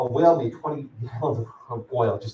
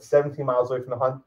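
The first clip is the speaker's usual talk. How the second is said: usual speaking voice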